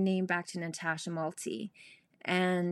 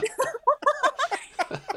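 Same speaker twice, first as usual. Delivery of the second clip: high, loud burst of laughing; real high-pitched laughing fit; self-amused laughter; 6 dB louder than usual. Real, not acted. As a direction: laugh helplessly at yourself. Laughs at her numerology phase.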